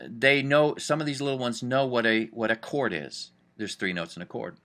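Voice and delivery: normal talking voice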